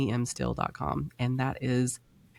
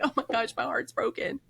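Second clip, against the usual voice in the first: much higher, hammed-up sorrow; mock heartbroken; 11 semitones higher than usual. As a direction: whimper it in mock despair. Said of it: "I'll be like".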